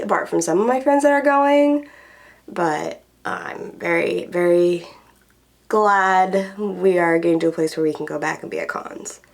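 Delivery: ordinary delivery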